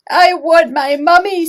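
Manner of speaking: put-on high, loud babyish wail; tiny owl wail. Bill the baby owl crying for mummy, high and loud. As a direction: wail it big for the children. Children join Bill's line.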